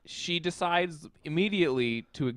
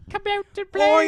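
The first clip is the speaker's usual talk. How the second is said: much higher, louder Cockney doll voice; a playful Cockney doll calling, pitched way up; creepy Cockney doll; 10 dB louder than usual. Retold or imitated. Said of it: "Cockney accent".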